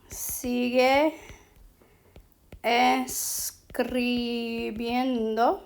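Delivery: very slow, syllables stretched out; slow dictation. Shown as a demonstration. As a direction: draw out each syllable as if writing it. Writing the example out word by word.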